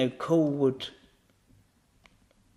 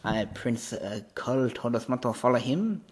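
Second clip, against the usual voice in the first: put-on French-accented English, hesitant; 4 dB quieter than usual. Guard repeats the prince's order, French accent. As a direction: correct yourself as a French guard. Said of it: "the only French person in Asgard".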